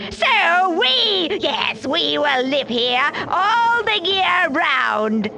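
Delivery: high, slow, eerie chant; snow and frost crying out. A narrator's reading. Snow and frost gloat over the giant's garden, high and slow. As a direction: stretch it out like a cold spirit. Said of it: "they cried".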